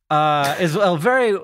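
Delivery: usual speaking voice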